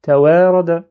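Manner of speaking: fast fluent reading, letters run together; a rushed demo of ta wa ra da. Shown as a demonstration. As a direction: rattle the syllables off quickly. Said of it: "mais très très rapide".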